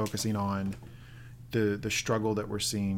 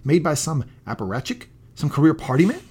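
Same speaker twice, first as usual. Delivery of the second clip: raised, indignant challenge to a superior; quoted angry outburst; 4 semitones higher, 7 dB louder than usual. Retold or imitated. Scientist challenging the party man, rising anger. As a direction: demand answers louder, incredulous.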